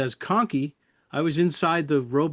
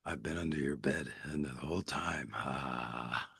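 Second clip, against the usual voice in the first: much lower, much softer gravelly puppet voice; deep rumbling puppet flower voice; low Flory voice; 11 semitones lower, 12 dB quieter than usual. Retold or imitated. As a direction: growl it low like a creature under the bed. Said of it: "he's got that low voice".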